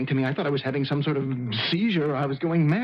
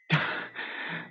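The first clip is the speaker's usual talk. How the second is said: weary sigh; 6 dB quieter than usual. Acted. Pianist overwhelmed after the concert.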